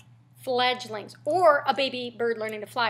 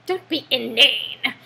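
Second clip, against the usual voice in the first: slow, louder mock-British put-down; British accent; 5 dB louder, slower than usual. Shown as a demonstration. Teacher showing a classier insult, in a British accent. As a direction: stretch it out in a haughty British accent. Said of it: "if you said it with a British accent".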